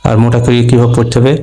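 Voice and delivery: normal talking voice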